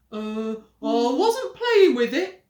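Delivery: slow, loud smooth talk; oily innocence. Acted. Henry weaseling out, slow smooth denial. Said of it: "Henry said smoothly".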